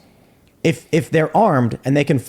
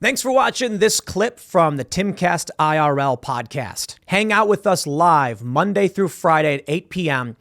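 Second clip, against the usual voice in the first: slow, higher-pitched promo read; podcast outro read; 4 semitones higher, slower than usual. Acted. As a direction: deliver it as a measured show sign-off.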